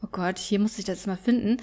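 usual speaking voice

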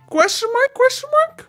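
very high, puzzled squeak; high-pitched bewilderment. Acted. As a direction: read it out sky-high in disbelief. Reads a shocked character's chat.

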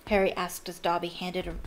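usual speaking voice

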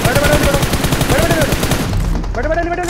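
loud, rapid repeated command; urgent shout. Real, not acted. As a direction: yell at teammates to get down. Urgent callout to crouch.

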